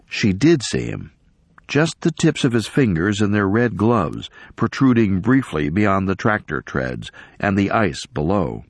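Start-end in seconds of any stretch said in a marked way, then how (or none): none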